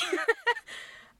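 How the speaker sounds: shrieking burst of laughter; loud, very high giggle. Real, not acted. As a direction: let out a squealing laugh. Laughs after punchline.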